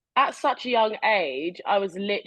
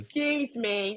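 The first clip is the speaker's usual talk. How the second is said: polite tut; 5 dB quieter, slower than usual. Retold or imitated. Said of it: "Are you a tutter?".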